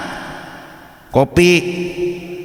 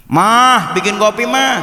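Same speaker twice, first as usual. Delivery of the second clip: raised call to the wife; husband calling his wife to make coffee; 6 semitones higher, slower than usual. Acted. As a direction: call toward the kitchen, higher. Host calls wife to make coffee.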